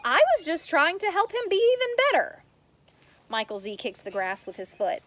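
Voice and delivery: plain reading voice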